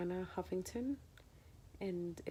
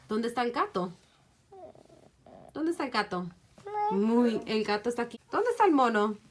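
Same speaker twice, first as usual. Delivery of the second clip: put-on loud, bright toddler read-aloud voice; reading to a child; 5 semitones higher, 12 dB louder than usual. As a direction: quiz the toddler on animals. Reads picture book to toddler.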